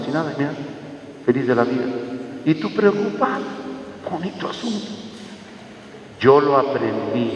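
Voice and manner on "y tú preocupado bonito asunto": high, put-on sarcastic voice; mock them for worrying, way up high; a sarcastic falsetto jab; mocking sneer. Mocking worried listener, acted.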